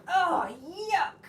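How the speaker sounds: high loud cry of disgust; shouted disgust. Acted. Parents shout at the dirty toy in disgust. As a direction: shout it in revulsion. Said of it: "They shouted".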